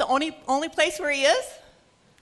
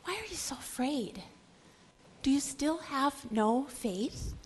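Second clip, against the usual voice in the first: gentle question; 6 dB quieter than usual. Acted. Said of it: "And he says to us".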